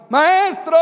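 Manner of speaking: loud, high call for help; panicked shout to a sleeping teacher; desperate cry. Acted. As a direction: cry out to wake the master. Disciples wake Jesus in storm.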